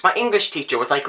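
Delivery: usual speaking voice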